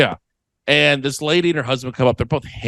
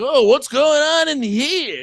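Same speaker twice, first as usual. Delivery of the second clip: put-on drunk lady; 12 semitones higher, slower than usual. Drunk lady voiced in story.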